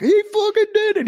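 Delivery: very high-pitched excited cry; retold amazement. Buddy celebrating the winning time, high-pitched excited exclamation. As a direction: imitate a friend going bananas at the result.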